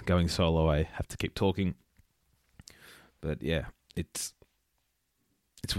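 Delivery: talking between sips of a drink; mid-sip, lips near the cup; while sipping a drink. Real, not acted. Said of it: "excuse me while I drink my, from my beverage".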